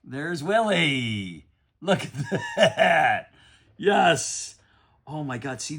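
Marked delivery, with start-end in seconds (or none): 0.0-4.5 high squeal, slowed and laughing; fired up. Real, not acted. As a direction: gush over Willie in disbelief. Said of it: "I'm pretty fired up".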